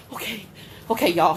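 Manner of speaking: ordinary talk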